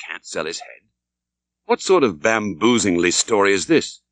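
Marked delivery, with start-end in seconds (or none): 0.1-0.8 much softer, puzzled repeat with the stress on "sell"; baffled echo. A narrator's reading. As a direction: mutter it back in disbelief to yourself. Narrator echoes, baffled, to himself.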